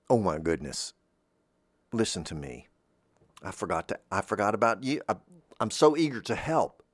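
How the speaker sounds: ordinary talk, nothing marked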